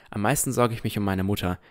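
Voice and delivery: normal talking voice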